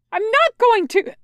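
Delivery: high, loud panicked refusal; angry and scared. A narrator's reading. Companion refuses to abandon him, angry scared shout. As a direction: shout back, angry and frightened. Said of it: "his voice angry and scared".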